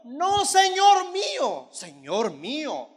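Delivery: high, loud, slow plea to the priest; a slow loud cry that drops on the repeat; pleading cry. Acted. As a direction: stretch it out, begging.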